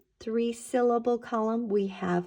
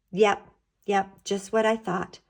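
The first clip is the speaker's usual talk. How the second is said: ordinary delivery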